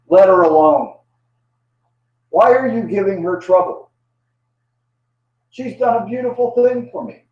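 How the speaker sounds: emphatic, high-pitched reproach; firm rebuke. Acted. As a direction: step in and scold them on her behalf. Jesus rebukes the angry onlookers.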